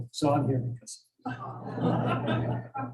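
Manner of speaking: whispered through a laugh; soft giggly repeat of the punchline. Real, not acted. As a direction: say it while still laughing at the punchline.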